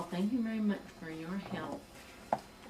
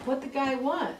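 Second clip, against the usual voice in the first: higher and louder, astonished; amazed; 6 semitones higher, 5 dB louder than usual. Retold or imitated. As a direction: ask it in sheer astonishment. Manager amazed at big sale.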